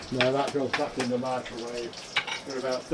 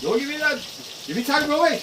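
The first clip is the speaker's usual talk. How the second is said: Geordie; 14 semitones higher, faster than usual. Retold or imitated. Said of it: "talking Geordie".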